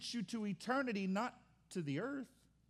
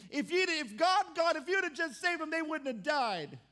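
higher, louder accusing plea; complaining to God; 6 semitones higher, 3 dB louder than usual. Retold or imitated. Church member blaming God, a louder whining plea. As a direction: whine it like a wronged believer.

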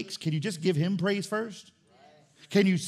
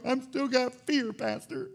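whiny congregant; 6 semitones higher, slower than usual. Pretend. Imagined whining congregant.

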